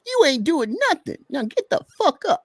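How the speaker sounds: high-pitched, loud character voice; Darren voice. Shown as a demonstration. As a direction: read Darren way up high and forceful. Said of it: "an example of how I read Darren".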